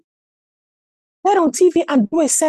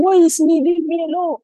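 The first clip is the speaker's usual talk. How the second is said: high, hammed-up wail of self-pity; mock woeful lament; 4 semitones higher than usual. Prisoner declaring his woes to fellow prisoners, mock woeful lament. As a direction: bemoan your lot in an exaggerated singsong. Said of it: "declaring his woes".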